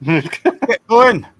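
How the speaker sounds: put-on Scottish accent. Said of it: "Did you do a Scottish accent".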